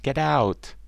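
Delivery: loud, high duckling jeers; shrieky storybook chorus of ducklings; quacked shouting. A narrator's reading. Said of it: "All of them started to quack".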